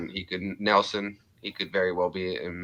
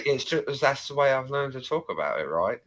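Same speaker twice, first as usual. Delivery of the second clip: quick, higher put-on London accent; 4 semitones higher than usual. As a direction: protest in a mock London accent. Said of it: "You always go to London".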